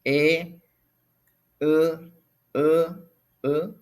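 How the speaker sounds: teacher models each vowel distinctly; vowel drill. Shown as a demonstration. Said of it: "la O con la diéresis".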